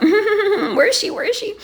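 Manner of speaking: put-on like a little kid waiting for the prank; a little kid too excited to wait; impatient kid. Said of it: "and I'm like".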